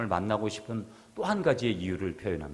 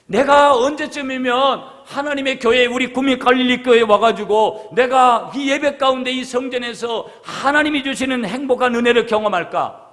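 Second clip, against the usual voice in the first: louder, rising preacher's longing; fervent preaching; 11 semitones higher, 8 dB louder than usual. Acted. Pastor preaching to the congregation, fervent and loud.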